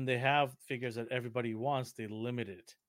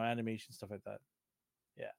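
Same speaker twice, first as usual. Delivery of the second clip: ordinary talk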